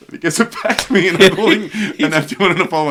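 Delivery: amused disbelief, chuckling as you tell it; laughing retelling. Real, not acted. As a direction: laugh at your own embarrassment. Laughing while retelling humiliation.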